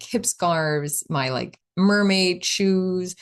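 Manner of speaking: usual speaking voice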